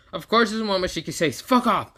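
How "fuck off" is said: high, sharp mock yell; angry shout. Momoshiki yelling at Boruto, angry shout. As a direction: hurl the curse.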